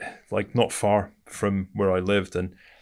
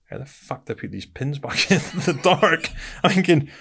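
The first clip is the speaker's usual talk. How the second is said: high laughing disbelief, louder; 9 semitones higher, 6 dB louder than usual. Real, not acted. Telling a mate about the grenades, laughing hard. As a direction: laugh through it, pitch climbing. Laughing recalling pins in dark.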